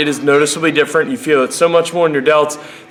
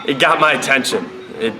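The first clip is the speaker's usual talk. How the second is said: ordinary delivery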